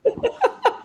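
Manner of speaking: real cackle; sudden high laugh; high burst of laughter. Real, not acted. Host laughs at own joke.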